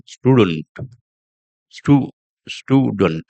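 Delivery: STUDENT with long 'oo' in the first syllable; slow 'stooo-dent' demonstration. Shown as a demonstration. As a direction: stretch the 'stu' of student. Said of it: "oo, oo sound".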